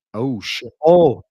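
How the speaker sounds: sudden loud shocked gasp of an oh; real alarm at the news, blurted out. Real, not acted. Reading the breaking news, shocked and loud.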